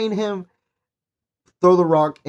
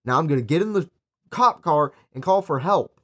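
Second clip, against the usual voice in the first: mock shocked; 8 semitones higher than usual. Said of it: "I'd be like".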